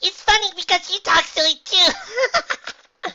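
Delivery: very high, loud giggly pony; giggling. A narrator's reading. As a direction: giggle through slow, squeaky words. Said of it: "Pinkie giggled".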